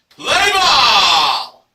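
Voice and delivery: loud, drawn-out ballpark shout; umpire-style call. Acted. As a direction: call out the start of the game. Umpire-style call to start the game.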